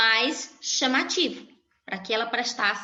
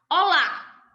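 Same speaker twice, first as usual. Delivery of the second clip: bright louder hello; cheerful greeting; 6 semitones higher, 4 dB louder than usual. Shown as a demonstration. Teacher greeting dear students, lifted cheerful hello. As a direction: greet the class warmly, up high. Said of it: "Quando eu saudei olá".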